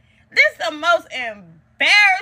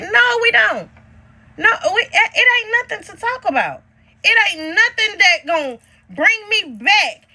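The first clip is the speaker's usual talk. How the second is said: heated refusal; 7 semitones higher than usual. Real, not acted. Rejects talking with sister.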